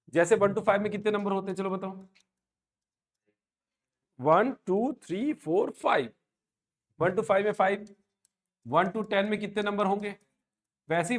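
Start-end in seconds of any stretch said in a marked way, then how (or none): 4.2-6.1 drawn-out, swooping count, one number at a time; loud teacher's count with gliding pitch on each number; counting aloud. Shown as a demonstration. Teacher counts one to five for the class, drawn-out singsong.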